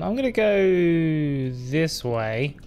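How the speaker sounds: very slow, careful words; slow nervous tiptoe. Real, not acted. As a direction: ease past it slowly. Creeping past the ghost.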